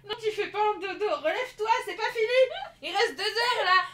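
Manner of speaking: high, loud and laughing; excited urging. Real, not acted. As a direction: push her on, laughing loudly. Urging a teammate to get up.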